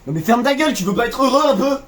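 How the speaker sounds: loud, high, laughing exasperation; playful shout. Real, not acted. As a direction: yell it back at your friend, half joking. Friend mocks his salad joy.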